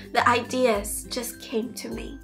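ordinary talk, nothing marked

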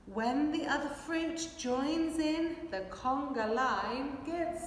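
ordinary delivery

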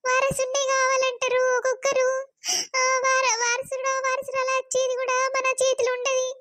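high, pleading lament to Shiva; a weeping complaint, pitched high; tearful lament. Acted. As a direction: cry out to god about women's lot.

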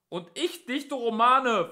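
slow, high, loud boast; drawn-out policeman's holler; shouted interjection. A narrator's reading. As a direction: butt in and proclaim it. Second policeman butts in, shouting.

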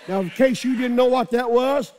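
ordinary talk

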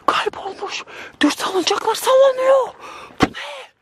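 high, shrill panic; terrified cry. Real, not acted. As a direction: cry out in real fright. Sees swings moving alone. (dehşetle haykırma)

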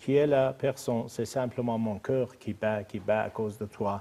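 poem recitation. Acted. Guest reciting a short French poem for translation, slow and lowering. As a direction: recite it slowly, letting each line drop.

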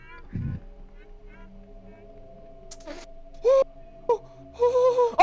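long high startled gasp; sharp frightened gasp. Real, not acted. Jump scare behind teammate.

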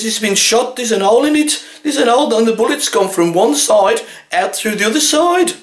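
high, insistent customer voice; mock the customer's rising outrage; outraged insistence. Wife insisting her chicken was shot, high and outraged.

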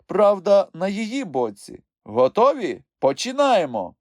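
high, loud voice of authority; judge opening court. A narrator's reading. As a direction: call the court to order.

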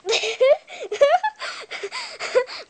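loud laugh. Real, not acted. Laughing at dad's camera work.

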